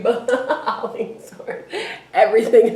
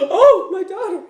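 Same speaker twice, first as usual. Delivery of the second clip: mock crying mother; 7 semitones higher, faster than usual. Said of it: "now a crying amoeba".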